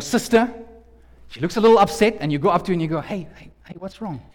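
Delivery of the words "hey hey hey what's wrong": softly asking what's wrong; gentle concern. Acted. Husband approaching his upset wife, softly concerned. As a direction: check in tenderly, very softly.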